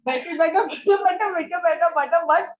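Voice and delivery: giggling high and loud over the words; laughing. Real, not acted. Teacher laughs and mimics the student's tangled twister. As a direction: laugh while mimicking the muddle. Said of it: "how did you end with the but?".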